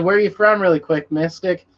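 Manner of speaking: ordinary delivery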